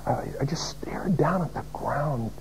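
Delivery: whispered, head bowed; hushed, humbled. Retold or imitated. Preacher recalling his shame before the martyr, whispered. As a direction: let it fall to a whisper.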